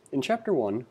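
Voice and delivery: usual speaking voice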